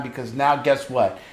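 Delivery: ordinary delivery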